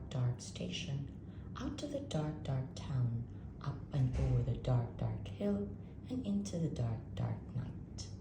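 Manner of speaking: ordinary delivery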